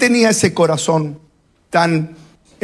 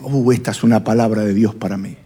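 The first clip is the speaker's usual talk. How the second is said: low, quick, lightly acted approval; murmur-like aside of a listener, pitched down; churchgoer's reaction; 10 semitones lower, faster than usual. Churchgoer takes the word personally, lightly acted low aside. Voices churchgoer receiving the sermon.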